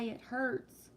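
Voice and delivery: ordinary talk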